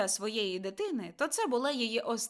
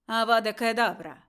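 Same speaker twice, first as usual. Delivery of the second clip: killing curse; 8 dB louder than usual. A narrator's reading. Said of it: "Цей голос сказав".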